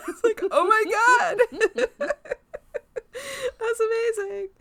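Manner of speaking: high, giggly words through real laughter; breathless high laughter, barely getting words out; laughing hard. Real, not acted. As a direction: squeal it out while cracking up. Cracking up at the episode.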